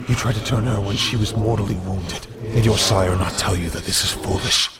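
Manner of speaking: put-on a stern elder rebuking a foolish young wolf; terse council woman. A narrator's reading. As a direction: cut him down, terse and cold. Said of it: "The woman's terse voice rang out".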